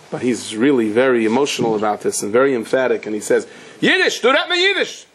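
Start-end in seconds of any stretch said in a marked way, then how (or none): 3.8-5.1 much higher, imitated yell of an angry old man. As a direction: mimic a fed-up old man shouting from his seat. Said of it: "and he's yelling".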